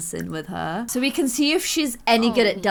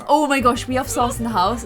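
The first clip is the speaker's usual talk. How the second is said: usual speaking voice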